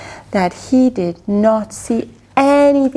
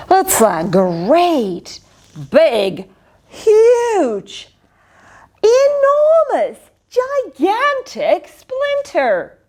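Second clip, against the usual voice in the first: building boast; 5 semitones higher, much slower than usual. Acted. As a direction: make each word bigger. Winston building the size.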